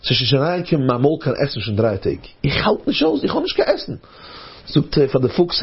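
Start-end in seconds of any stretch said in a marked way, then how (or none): 2.4-4.0 high-pitched acted complaint; hungry complainer. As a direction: complain in a raised character voice.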